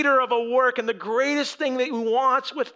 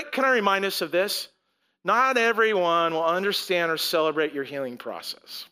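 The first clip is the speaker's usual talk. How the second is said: ordinary delivery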